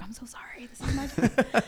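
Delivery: apologetic whisper. Retold or imitated. Apologizing to Tina Fey on set, in a whisper. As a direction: whisper sorry as if not to offend. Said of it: "I just apologized".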